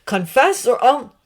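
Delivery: loud, high threat cut off; threatening. Acted. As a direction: snarl the threat. Sirius threatening.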